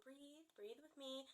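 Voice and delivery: put-on soft, slow coaxing to a toddler; soothing. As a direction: gently coax him to breathe. Parents soothing upset toddler.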